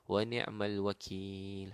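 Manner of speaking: slowly drawn-out Arabic invocation; slow recitation. Retold or imitated. Preacher quoting Ibrahim in the fire, slow recitation. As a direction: stretch out each phrase of the prayer. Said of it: "Tapi dia kata apa?".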